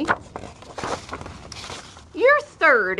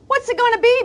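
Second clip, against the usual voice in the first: much higher, louder pushy question; excited challenge; 12 semitones higher, 4 dB louder, much faster than usual. Acted. Boy challenging the mynah bird, high and eager. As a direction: sell it like a pitch.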